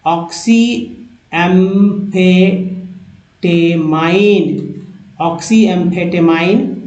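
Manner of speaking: एम्फेटेमाइन stretched out slowly, part by part; teacher drawing out एम्फेटेमाइन; slow syllables. Shown as a demonstration. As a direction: sound out एम्फेटेमाइन slowly for learners. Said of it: "teacher spells out a long word".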